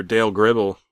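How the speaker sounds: ordinary delivery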